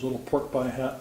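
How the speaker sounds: ordinary delivery, nothing marked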